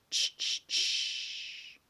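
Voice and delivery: short SH puffs ending in a long hiss; old train chuffing. Shown as a demonstration. Teacher imitating the old train, rhythmic SH puffs. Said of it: "le bruit du vieux train".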